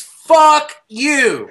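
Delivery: high, loud, drawn-out punchline; hammed-up catchphrase. Storyteller revealing what is engraved on the bullet, hammed-up. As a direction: land it big, echoing the mimicked catchphrase.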